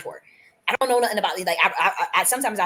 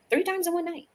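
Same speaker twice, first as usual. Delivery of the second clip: THREE pitched up sharply; emphatic; 12 semitones higher than usual. Real, not acted. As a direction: hit Three high and insistent. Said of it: "like, three times in one night".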